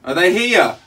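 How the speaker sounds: loud, high yelled question; shouting. Real, not acted. Trapped caller asking if rescue is coming, shouting. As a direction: yell it through a wall.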